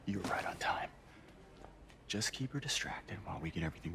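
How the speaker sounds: whisper. Acted. Secret surprise party planning.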